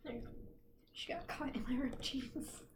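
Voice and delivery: hushed whisper; soft whispered aside. Real, not acted. Quiet aside about pet.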